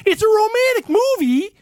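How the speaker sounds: high, loud mocking disbelief; incredulous squawk. Real, not acted. Echoing his wife's claim in high, loud disbelief. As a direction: throw her words back, outraged.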